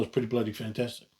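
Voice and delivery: ordinary delivery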